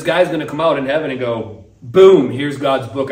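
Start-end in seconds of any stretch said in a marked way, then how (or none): 1.8-3.1 loud, slow, booming declaration; accuser's booming announcement. Acted. Accuser at heaven's gate booming at the sinner. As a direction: slam the book down for all to hear. Accuser opening God's book.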